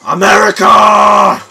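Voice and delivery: put-on loud, drawn-out shout in Russian accent. Player cheering for America, shouted Russian accent. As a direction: bellow it high with a mock Russian accent. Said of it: "say that in a Russian accent".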